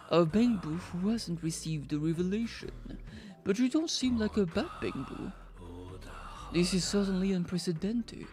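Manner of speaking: a lightly voiced, slow grand prophet; solemn prophet. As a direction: intone it like a self-important cult leader. Voices the Bang Boo prophet.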